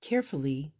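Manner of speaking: usual speaking voice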